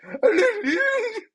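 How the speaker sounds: mock fighter yell. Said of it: "He's just like".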